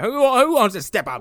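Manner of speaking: high Cockney challenge; hammed-up Cockney, looking for a fight; Cockney Michael Myers. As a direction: egg them on in put-on Cockney. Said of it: "Michael Myers is just Cockney".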